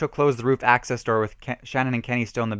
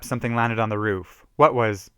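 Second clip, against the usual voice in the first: ordinary delivery, nothing marked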